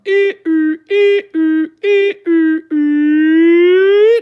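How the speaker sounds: loud, very high two-tone siren; imitated car alarm. Mimicking a bird copying car alarms, piercing siren. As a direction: wail like a car alarm, last note held. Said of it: "A car alarm".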